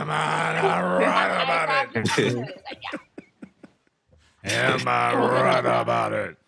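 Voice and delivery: long, throaty wrestler-like preacher shout; Jennings. Acted. Teacher mimicking that tough preacher to laughter, exaggerated drawn-out shout. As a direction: stretch it into a huge throat-straining bellow. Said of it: "That joker say".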